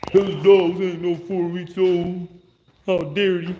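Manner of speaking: mock the haters in a prissy high voice; hammed-up scandalized critic, drawn out high. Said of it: "People talk about".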